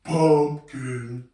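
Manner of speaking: low, slow pumpkin voice. Shown as a demonstration. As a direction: drop the voice for a big pumpkin. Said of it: "go really low voice".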